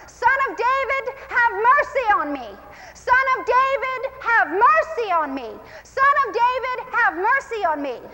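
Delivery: high, pleading cry for mercy. Acted. Blind beggar calls to passing Jesus, crying out. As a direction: call out high and insistent, drawing out the plea. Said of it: "He begins to cry out".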